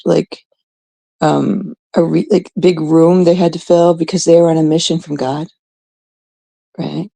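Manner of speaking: ordinary delivery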